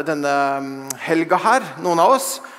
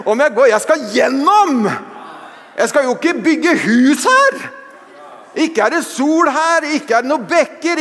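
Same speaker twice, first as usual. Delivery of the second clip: raised, ringing preaching voice; preaching at full force; 6 semitones higher, 3 dB louder than usual. Real, not acted. Preacher urging the church to keep going, raised. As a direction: preach it with fire.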